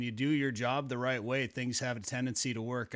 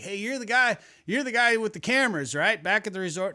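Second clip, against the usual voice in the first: higher, louder mock Southern hail; Southern accent; 7 semitones higher, 5 dB louder than usual. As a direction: call out in a put-on Southern accent. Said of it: "they have a Southern accent".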